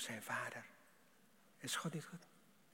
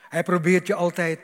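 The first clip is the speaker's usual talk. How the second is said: loud warning cry; loud pulpit warning; preaching shout; 4 semitones higher, 15 dB louder than usual. Acted. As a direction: warn the congregation loudly. Preacher warns of the evil one.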